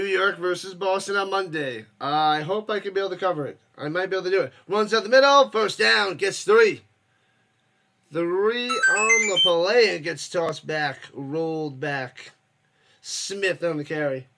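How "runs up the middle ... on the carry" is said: loud, excited play-by-play call; fired-up sportscaster calling the action. Real, not acted. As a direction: call the drive like a live announcer. Live play-by-play of a game.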